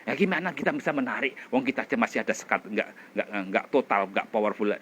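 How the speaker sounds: normal talking voice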